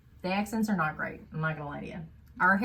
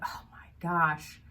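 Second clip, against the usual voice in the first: exasperated sigh; 2 semitones lower, 3 dB quieter, slower than usual. Retold or imitated. Heroine quoted, exasperated.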